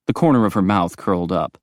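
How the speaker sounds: plain reading voice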